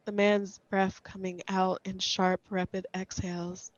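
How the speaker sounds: ordinary delivery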